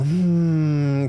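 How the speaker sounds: long, steady hum on the out-breath; exhaling hum. Shown as a demonstration. Yoga teacher showing the exhale for six, exhaling hum. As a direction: let the exhale out as a sustained "hmm".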